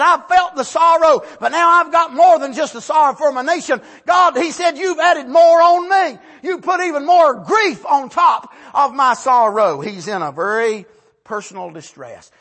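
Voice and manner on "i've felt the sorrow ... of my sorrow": loud, rising grieving plea; loud lament. Retold or imitated. Barak telling God his grief has grown, loud and pleading.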